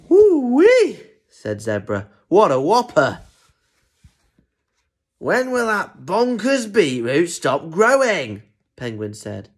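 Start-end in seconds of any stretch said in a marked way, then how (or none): 0.1-1.0 high, loud whoop of amazement; amazed whoop. Acted. Zebra seeing the giant beetroot, amazed whoop. 2.3-3.2 higher, loud marvelling; impressed exclamation. Acted. Zebra admiring the enormous beetroot, impressed exclamation. 5.2-8.4 high, slow, exasperated complaint; grumpy penguin. Acted. Penguin fed up with the growing beetroot, grumpy complaint. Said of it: "Probably still gonna be grumpy".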